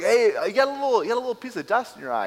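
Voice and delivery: high, fast, breezy mock helpfulness; hammed-up clueless busybody; mock oblivious guy. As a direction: play the clueless guy pointing out dust. Mocking the oblivious plank guy.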